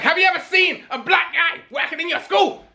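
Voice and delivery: high, put-on voice mocking a sly official. Mocking the tracers questioning a five-year-old, high put-on voice. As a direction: ham up the official's leading question. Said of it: "I bet in the end they were just like".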